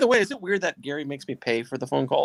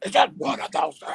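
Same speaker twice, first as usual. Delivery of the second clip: [name] shout; 8 semitones higher than usual. Pretend. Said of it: "shouted out like [name]".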